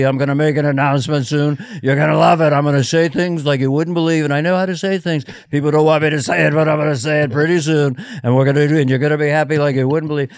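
boastful sing-song politician rhythm; Donald Trump. Acted. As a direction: tease a big announcement, phrases rolling on. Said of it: "'cause Trump is so easy".

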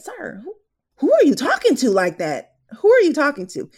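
high, loud incredulous who-are-you-talking-to; indignant inner retort. Retold or imitated. Nurse silently answering the rude son, indignant and loud. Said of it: "this is what I'm saying in my head".

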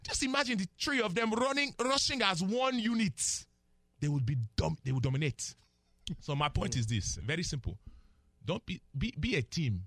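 put-on emphatic, playful Nigerian accent. Analyst urging three rushers to act as one, in Nigerian accent. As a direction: make the point in a Nigerian accent. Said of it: "the accent".

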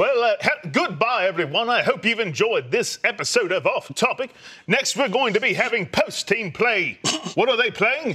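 grand, swooping English actor voice; Matt Berry. Pretend. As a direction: sign off like a plummy British thespian. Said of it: "I need you to say goodbye in that voice".

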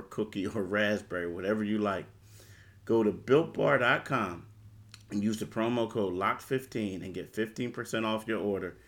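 upbeat sponsor read; hard sell ad. Acted. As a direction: sell it like a radio spot. Host reads sponsor ad.

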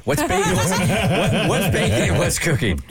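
giggling while asking; laughing question. Real, not acted. Asking the hosts, laughing at her own dumb question.

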